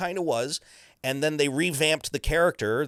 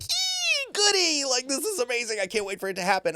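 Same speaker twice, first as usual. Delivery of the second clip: very high, squealing with glee; hammed-up giddy squeal; giddy excitement; 13 semitones higher than usual. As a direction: gush like a kid on Christmas. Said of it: "I'm just like".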